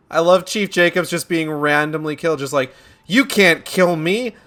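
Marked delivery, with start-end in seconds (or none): 3.1-4.4 mock indignant protest. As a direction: protest like a hammed-up outraged police chief. Mocks killed police chief's protest.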